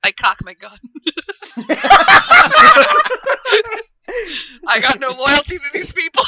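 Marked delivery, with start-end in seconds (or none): 0.8-3.8 loud, high cackling laugh; maniacal laugh. Real, not acted. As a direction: cackle with glee. Gleeful laugh at betrayal.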